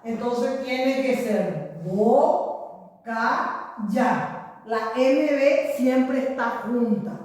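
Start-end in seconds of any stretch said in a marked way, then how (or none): 1.8-4.6 slow syllable by syllable split; sounding out words. Shown as a demonstration. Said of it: "Vamos a separar en sílabas".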